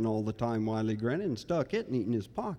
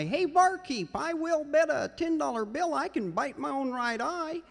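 much higher barroom braggart; screechy high voice. Acted. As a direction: squeal the bet up high. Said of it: "in a voice that was screechy and high".

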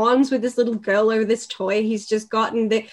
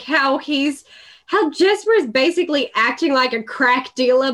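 normal talking voice

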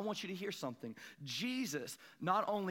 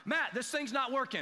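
raised, higher, exasperated call to a friend; louder, higher griping at the friend on the river; frustrated complaint; 6 semitones higher, 4 dB louder than usual. Retold or imitated. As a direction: complain loudly that the rod is useless. Frustrated while fishing, complaining.

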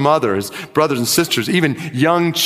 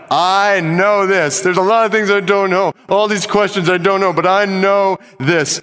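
preaching Job's cry; 5 semitones higher than usual. Acted. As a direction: proclaim it like a fervent preacher.

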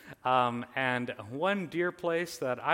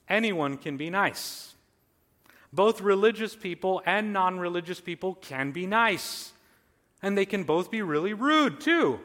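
loud, high, emphatic sermon; forceful preaching; 4 semitones higher, 7 dB louder than usual. Real, not acted. Preacher declaring anyone can be nice, forceful. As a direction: punch out the truth to the congregation.

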